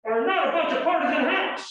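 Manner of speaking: high, loud emphatic quote. Retold or imitated. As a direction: hammer it home as she did. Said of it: "very emphatically".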